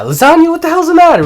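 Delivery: high, loud, rapid mock scolding; hammed-up exasperated yelling; incredulous outburst. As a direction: explode in disbelief. Acted outburst at absurd food request.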